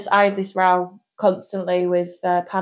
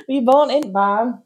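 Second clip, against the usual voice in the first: put-on Yorkshire questioning; lightly hammed-up Yorkshire accent; 3 semitones higher than usual. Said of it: "that's more Yorkshire accent".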